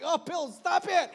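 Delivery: high, loud comic objection; mock protest. Sleeper fighting off his seductive pillow, mock protest. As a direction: fend it off with hammed-up exasperation.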